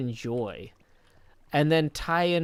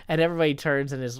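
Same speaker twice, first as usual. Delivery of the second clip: ordinary talk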